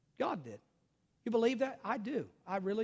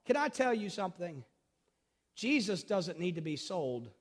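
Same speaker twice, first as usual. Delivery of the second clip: ordinary talk, nothing marked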